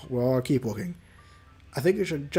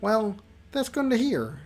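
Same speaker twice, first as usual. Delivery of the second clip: lightly voiced coworker, pitched higher; slow, higher character voice; original character voice; 6 semitones higher than usual. Coworker answers good news, in a lightly put-on higher voice. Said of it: "that's the voice I was originally doing".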